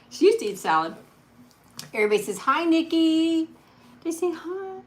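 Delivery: sing-song hello to the dog. Real, not acted. Greeting the dog for viewers.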